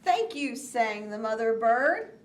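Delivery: lively, animated read-aloud; reading to children. A narrator's reading. Teacher reading Kite Day to young children, animated. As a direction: read it with big storybook energy. Reads picture book aloud.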